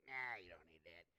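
low, scoffing brush-off; dismissive. Retold or imitated. New believer brushing off being told to read the Bible, dismissive.

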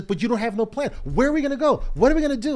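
high, loud questions piling up; wife's worried pushback. Retold or imitated. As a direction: press him with alarmed questions. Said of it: "She says".

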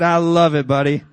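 very loud mock-fierce dad; booming, playful retort to a child; hammed-up gusto. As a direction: belt it out as a relishing father. Father's hammed-up retort in story.

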